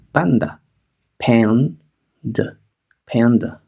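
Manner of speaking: "Panda" with the final a reduced to schwa, pan-duh; reduced second vowel. Shown as a demonstration. Teacher modelling for students, reduced final vowel. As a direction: say "Panda" letting the last a go to schwa. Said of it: "Reducimos el segun-, la segunda vocal".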